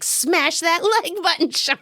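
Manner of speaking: mock demon ghost, pitched high. Ghost banging Sean's head on the floor, mock demon voice. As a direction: put on a little high ghost voice, laughter creeping in. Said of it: "in her little, like, demon ghost voice".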